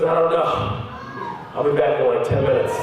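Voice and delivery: lightly voiced low doctor; a hint of a low-pitched doctor; retold doctor. Doctor leaving the patient waiting, lightly voiced low. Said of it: "He goes".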